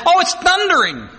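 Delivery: high-pitched onlooker's cry; bystander's exclamation. Acted. Bystanders explaining the voice from heaven, high exclamation. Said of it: "heard it said".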